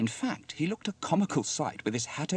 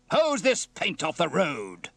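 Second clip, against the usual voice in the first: slow, loud, raised-pitch command; barked order; 7 semitones higher, 7 dB louder, slower than usual. Acted. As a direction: bellow each word at the crew. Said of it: "barked Steele".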